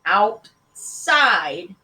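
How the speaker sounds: loud, drawn-out single-word order; unbudging father's command; stern repeat. A narrator's reading. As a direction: insist firmly, splitting the word in two.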